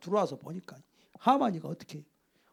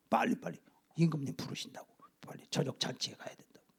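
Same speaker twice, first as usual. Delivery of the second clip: urgent hushed summons; 3 semitones lower, 11 dB quieter than usual. Acted.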